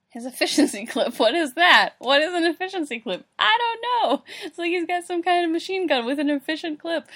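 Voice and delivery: loud, high, incredulous outburst; baffled rant. Real, not acted. Reader ranting at efficiency clip, loud and baffled. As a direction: build to a loud exasperated yell.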